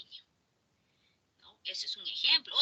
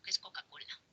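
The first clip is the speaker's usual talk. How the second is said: puzzled whisper; 15 dB quieter, faster than usual. Retold or imitated. Asking what a slang word means.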